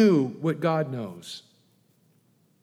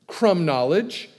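louder, higher sarcastic retort; mocking challenge; 4 semitones higher, 6 dB louder than usual. Preacher challenging Mister Crumb Knowledge, loud and mocking. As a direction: scoff at the know-it-all.

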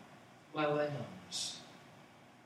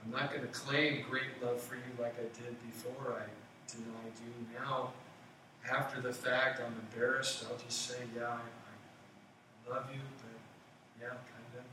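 quiet, embarrassed, much softer mumble; sheepish Peter; 4 semitones lower, 6 dB quieter than usual. Retold or imitated. Peter answers Jesus after denying him, quiet and embarrassed. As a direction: hedge it like a guilty friend. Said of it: "Peter said".

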